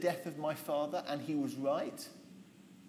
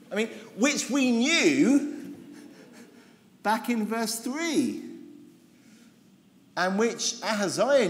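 higher, louder, slow sarcastic disbelief; exasperated; 6 semitones higher, 5 dB louder, slower than usual. Real, not acted. Preacher mocking the futile army losses, exasperated. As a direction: stretch each phrase as if the point is absurd.

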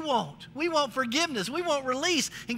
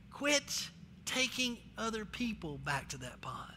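much softer, pleading; soft earnest plea; 4 semitones lower, 11 dB quieter than usual. Real, not acted. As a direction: drop to a quiet appeal to the church.

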